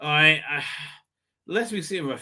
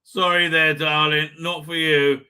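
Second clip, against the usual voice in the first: ordinary talk, nothing marked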